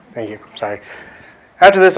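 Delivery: ordinary talk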